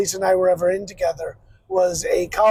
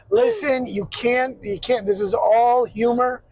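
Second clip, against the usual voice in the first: ordinary talk, nothing marked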